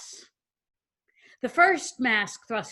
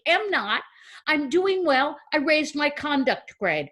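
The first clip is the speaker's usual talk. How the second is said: put-on higher-pitched, indignant child's protest; defensive retort; 6 semitones higher, 3 dB louder than usual. Child defends herself to her sister, defensive retort. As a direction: push back like an accused kid.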